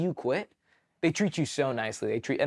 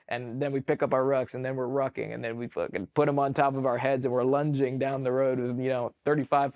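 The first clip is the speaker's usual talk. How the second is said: usual speaking voice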